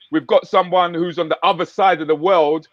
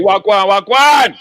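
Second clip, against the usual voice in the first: Jamaican patois greeting; 4 semitones higher, 7 dB louder, slower than usual. Acted. Greeting a guest in slang.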